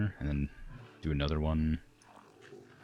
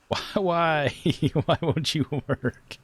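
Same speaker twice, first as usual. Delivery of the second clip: high, loud desperate pleading; begging the game to cooperate; pleading with a machine; 11 semitones higher, 5 dB louder, slower than usual. Real, not acted.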